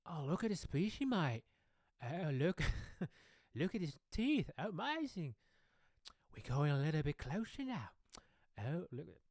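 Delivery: excitable Australian wildlife presenter; high, wide-eyed Aussie nature-show commentary; Steve. Retold or imitated. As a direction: marvel at an animal in a broad Australian accent. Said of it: "I listen to Steve".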